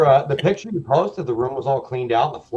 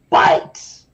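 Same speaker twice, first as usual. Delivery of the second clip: sudden harsh yell; explosive shouted punchline; Tom Segura; 6 dB louder than usual. Retold or imitated. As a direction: bellow one word, comedian's bit. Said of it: "comedian Tom Segura says".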